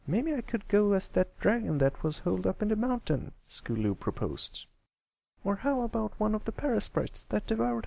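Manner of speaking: high filly voices, playful jabs; bantering fillies. Acted. The Crusaders joking about costumes, high playful filly voices. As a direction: trade costume jokes.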